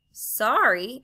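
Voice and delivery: a loud, rising "Sorry?" in disbelief; indignant exclamation. A narrator's reading. Diggory, stung by Polly's demand for an apology: loud indignant "Sorry?". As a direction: throw the word back at her, offended. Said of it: "exclaimed Diggory".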